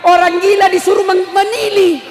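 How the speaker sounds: loud, high, incredulous preacher; high-pitched, forceful sermon outburst; impassioned preaching. Real, not acted. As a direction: cry it out to the congregation.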